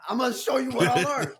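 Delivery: hammed-up tough-guy line; mock threat. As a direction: threaten like an anime fighter, joking. Mock threat over anime.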